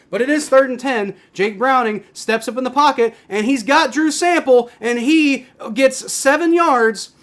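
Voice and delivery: loud, high live football call; play-by-play. Real, not acted.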